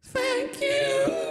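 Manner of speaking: tiny, very high sung fairy voice; high sung thanks; high register. Pretend. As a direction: chirp it way up in falsetto. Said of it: "the high register voice again".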